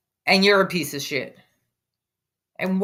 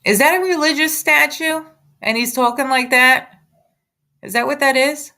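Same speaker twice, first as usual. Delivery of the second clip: higher, disbelieving questions; pitched-up outraged disbelief; incredulous; 7 semitones higher than usual. Real, not acted. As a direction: sound scandalised at the statue. Streamer points out the statue.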